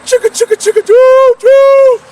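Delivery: high, loud chugging steam train sound; playful choo-choo train call; train noise. Retold or imitated. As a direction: imitate a locomotive pulling out. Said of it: "that sucker was like a train".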